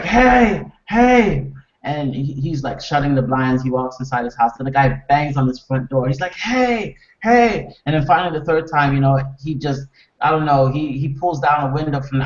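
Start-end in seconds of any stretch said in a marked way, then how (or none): 0.0-1.5 high, raised, drawn-out hail; hammed-up shout for attention; acted calling out. Neighbour banging to get attention, retold. 6.3-7.7 high-pitched insistent hail; hammed-up call for attention; acted calling out. Neighbour banging on door, retold.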